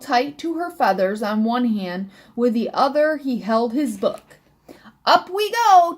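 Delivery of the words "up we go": high, loud, lifting bird chirp; chirping sparrow. A narrator's reading. Miss Sparrow takes off with elf aboard, high loud chirp. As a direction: chirp it out brightly on take-off. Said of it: "chirped Miss Sparrow".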